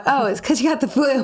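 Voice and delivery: mock answering voice.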